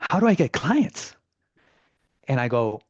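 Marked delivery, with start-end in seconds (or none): 0.1-1.2 a high, nervous question voiced for the kid; scared question. Narrator voices the scared kid asking the agent for help, high and nervous. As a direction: ask it like a nervous kid afraid to fail. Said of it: "he looks kinda scared".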